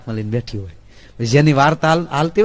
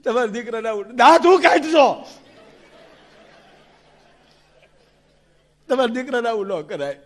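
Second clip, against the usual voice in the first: crying wife; 8 semitones higher than usual. Acted. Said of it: "રડતી રડતી".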